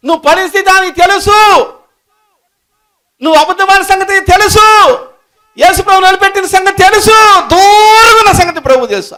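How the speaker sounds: loud, high, driving sermon voice; full-force preaching. Real, not acted. Preacher assures fallen believers God won't abandon them, preaching at full force. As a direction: hammer each line home to the crowd.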